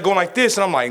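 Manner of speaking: ordinary talk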